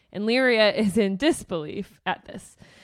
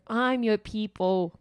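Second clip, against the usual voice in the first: a botched attempt at an Irish lilt; lightly put-on, vaguely Irish, not quite landing; weird not-Irish accent. Sister pleading to Volga in a failed Irish accent. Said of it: "like a weird, not Irish accent".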